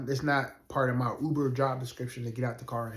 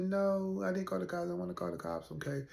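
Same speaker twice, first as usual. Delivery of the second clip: higher, softer mimicked young woman; imitated reluctant refusal; 7 semitones higher, 5 dB quieter, faster than usual. Passenger asked about calling the cops, imitated soft reluctant refusal. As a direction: quote her in a small, high voice.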